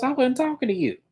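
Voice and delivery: high-pitched dismissive comeback; mock put-down in a high voice; retold rebuff. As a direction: imitate a dismissive reply, pitched well up. Retold snappy reply in a quarrel.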